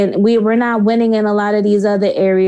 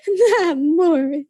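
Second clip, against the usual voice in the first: mock crying; 6 semitones higher, slower than usual. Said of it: "I said".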